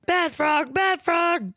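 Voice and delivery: high shouted Animal voice; Animal yelling. Retold or imitated. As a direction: bark it like a wild drummer. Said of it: "he keeps yelling evil or bad frog".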